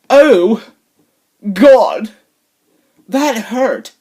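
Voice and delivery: high, loud mock-pained whine; mock wimpy complaint. Vlogger mocking a polite toe-stubber, hammed-up wimpy pain. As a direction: overplay a feeble, clean-mouthed reaction. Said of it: "No, you frickin' wiener".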